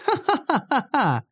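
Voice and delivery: high staccato mocking laugh; hammed-up high ha-ha laugh; acted ringing female laughter; peals of high laughter. A narrator's reading. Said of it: "заливисто рассмеялась Кара".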